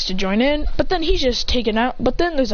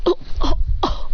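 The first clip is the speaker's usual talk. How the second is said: short, high strangled grunts; choking gasps; 8 semitones higher than usual. Acted. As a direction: play someone being choked, in little bursts. Acts being choked.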